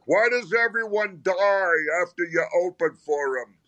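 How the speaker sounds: high, needling jab at a friend; Ray's mock question. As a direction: toss it off like a teasing buddy. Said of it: "Ray's like".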